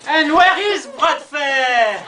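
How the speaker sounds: mock English accent, slow and shouted. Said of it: "un coyote qui avait l'accent anglais".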